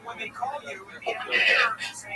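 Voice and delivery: put-on the godfather instructs the boy, slow and secretive; hushed godfather. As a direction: draw out "Open your hands" like a mobster passing something under the table. Godfather quoted at the steakhouse.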